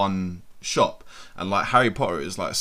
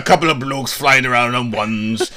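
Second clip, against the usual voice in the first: loud, scornful put-on Welsh voice; mock Hatch grumble; 8 dB louder than usual. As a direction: bellow it like a disgusted old bloke. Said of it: "he would have thought this was nonsense".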